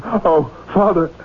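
high, drawn-out cry for help; desperate plea. Acted. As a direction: plead in despair, pitch climbing to the end. Professor breaks down, begging the priest.

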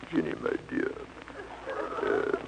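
hammed-up Romeo crooning very low. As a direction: drop the voice deep and ooze charm. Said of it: "you merely lower your voice".

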